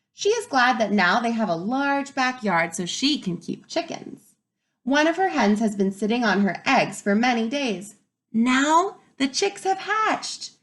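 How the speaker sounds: lively storybook read-aloud; reading to children. Acted. Teacher reading to her class, lively and expressive. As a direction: bring the story alive for the kids.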